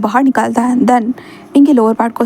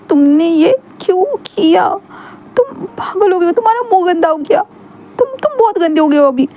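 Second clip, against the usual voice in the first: put-on shaken young man; 7 semitones higher than usual. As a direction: scold him with a shaky hurt voice. Wing scolds afterwards.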